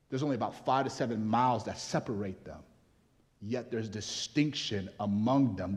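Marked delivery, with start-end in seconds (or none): none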